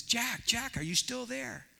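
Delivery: high-pitched hailing call. Acted. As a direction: check if someone below is still there. Holy Spirit calls down to Jack.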